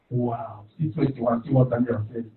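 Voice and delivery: usual speaking voice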